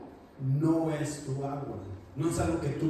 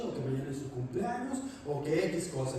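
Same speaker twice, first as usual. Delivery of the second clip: ordinary talk, nothing marked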